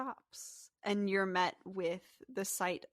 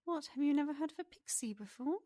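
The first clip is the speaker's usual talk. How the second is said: high, quick pixie voice; pixie character voice; 7 semitones higher, much faster than usual. Acted. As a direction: voice a pixie, pitched up and incredulous. Game master plays the pixie.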